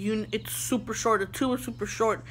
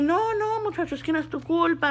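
higher, quicker reassurance; mock customer, fast; 6 semitones higher, faster than usual. Customer in a hurry to play soccer, high and fast. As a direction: rush the kind customer.

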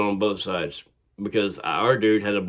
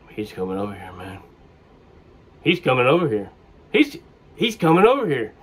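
rising alarm; 2 semitones higher than usual. Retold or imitated. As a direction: warn a teammate with growing panic. Assistant coach alarmed at charge.

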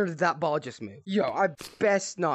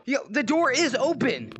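excited insistence; 8 semitones higher, 3 dB louder than usual. Real, not acted. Answering friend about door.

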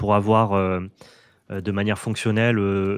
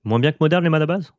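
higher, louder questioning pitch; incredulous question; 6 semitones higher, 5 dB louder than usual. Real, not acted. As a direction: question it in raised, surprised disbelief. Disputing the other player's claim.

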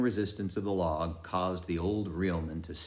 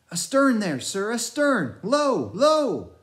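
put-on high, loud boyish crying out; a lost, mad boy calling across the water; crazed boy Pip; 8 dB louder than usual. A narrator's reading.

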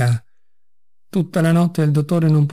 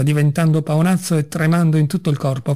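ordinary delivery

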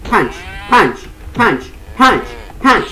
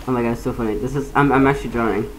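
usual speaking voice

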